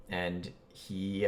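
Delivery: normal talking voice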